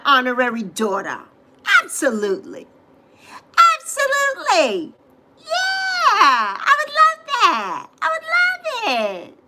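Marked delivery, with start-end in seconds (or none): 3.8-4.9 much higher, delighted squeak; squeaky high. Real, not acted. Answers then repeats higher. 5.4-9.4 much higher, excited squeaky voice; delighted squeal. Real, not acted. Accepting honorary daughter.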